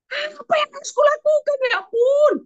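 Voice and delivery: high, frantic, rushing; hammed-up panic, voice up high; desperate king. The king asks what to do, high frantic panic.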